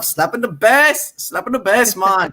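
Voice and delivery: slow, high mock Paul Rudd line; imitating Paul Rudd. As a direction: drawl it out like a movie quote. Quoting Paul Rudd's famous line.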